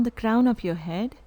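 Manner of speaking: calm guided meditation with long pauses; unhurried, evenly spoken meditation instructions. Real, not acted. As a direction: lead them into stillness, leaving silence between phrases. Leads a breath meditation.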